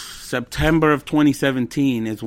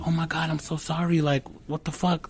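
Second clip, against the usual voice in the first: softer, hushed retold apology; imitating startled apology; 2 semitones higher, 7 dB quieter than usual. Imitating his girlfriend apologizing when he cried, hushed. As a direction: drop the volume, quote her concern.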